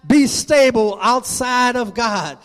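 ringing, insistent sermon delivery; full-force preaching. Acted. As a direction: preach it hard, stretching the key phrases.

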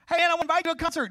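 bright, quick, high-pitched invitation; eager door-knocker. Retold or imitated. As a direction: sell the concert brightly.

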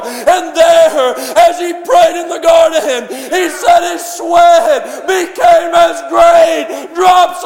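fiery preaching. Acted. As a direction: preach it hard to the whole church. Preaching at full force.

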